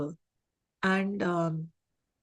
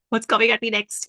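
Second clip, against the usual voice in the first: higher, louder, on edge; startled rider; 4 semitones higher, 7 dB louder than usual. Acted. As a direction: blurt it out like a jumpy thrill-rider. Acting out startled rider on coaster.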